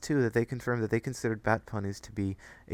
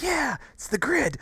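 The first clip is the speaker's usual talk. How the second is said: high mock voice.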